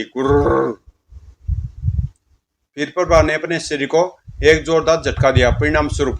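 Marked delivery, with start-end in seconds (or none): 0.0-0.7 strange growl. Acted. Possessed bride growls at the people holding her. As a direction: growl like something inside her. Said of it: "एक विचित्र आवाज निकली".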